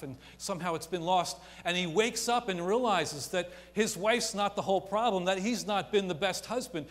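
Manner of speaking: swelling sermon voice; impassioned preaching. Acted. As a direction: drive it home from the pulpit. Preaching at full force.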